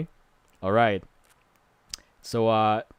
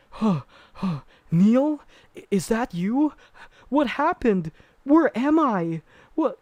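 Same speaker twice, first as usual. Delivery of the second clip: high, breathy-voiced dazed wife; confused ghost wife; 11 semitones higher, 3 dB louder than usual. Man recounting a horror film voices the dead wife, high and dazed. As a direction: play the dead wife waking, lost and high.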